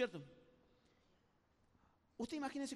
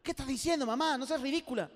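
annoyed son; 3 semitones higher, 7 dB louder than usual. Retold or imitated. Son rejects mother's baby talk.

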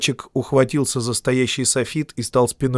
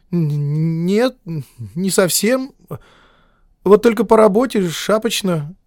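nervous stammer; 7 semitones higher than usual. A narrator's reading. Editor stammering.

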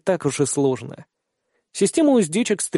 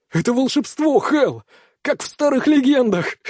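high, delighted shout into the wind; joyful yell of a thrilled youth; 9 semitones higher than usual. Acted. Kihara thrilled in flight.

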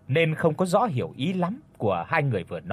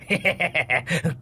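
long sneering villain laugh; menacing snicker; 3 semitones higher than usual. A narrator's reading. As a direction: laugh cruelly at the victim. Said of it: "còn cười gằn lên mà nói".

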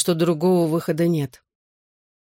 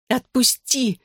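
high-pitched cry at normal volume; narrated high yelp of protest; mock cry out; 7 semitones higher, slower than usual. A narrator's reading. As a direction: cry out to be released, without full volume. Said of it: "крикнула я".

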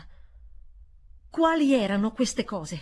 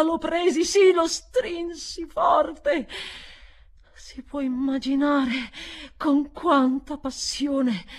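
passionate narration; 7 semitones higher, 7 dB louder, slower than usual. A narrator's reading. Narration swells.

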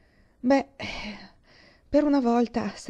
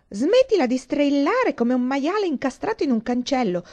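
a loud, bossy command, a touch higher; bossy order; 6 semitones higher, 7 dB louder than usual. A narrator's reading. George Andrew orders the screaming girl to stop, loud and bossy. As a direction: snap loudly at her to stop the noise. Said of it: "ordinò George Andrew".

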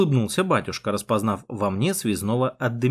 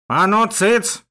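put-on high, forceful bark from an old priest; barked order; 8 semitones higher, 4 dB louder, much slower than usual. A narrator's reading. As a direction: bark them into silence with surprising vigour. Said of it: "гаркнул батюшка совсем не по-стариковски".

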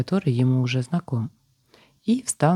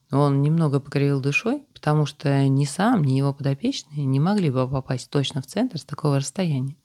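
ordinary delivery

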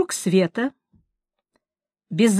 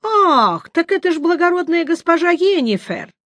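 mock surprise; 10 semitones higher, 4 dB louder than usual. Mock surprise greeting sorceress.

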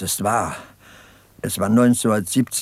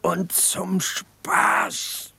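whisper; 6 semitones higher, 4 dB quieter, much slower than usual. Acted. Soldier ends war story quietly.

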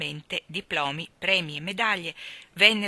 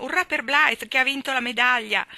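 loud, high triumphant cheer; shouting hoarse; 7 semitones higher, 9 dB louder than usual. A narrator's reading. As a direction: yell it like a crowd carrying the winner. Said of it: "si sgolavano".

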